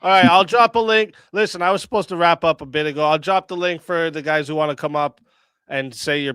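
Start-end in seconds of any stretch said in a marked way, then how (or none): none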